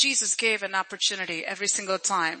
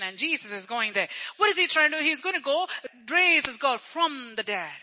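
usual speaking voice

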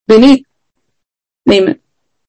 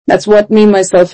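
normal talking voice

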